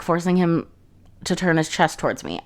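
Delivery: ordinary talk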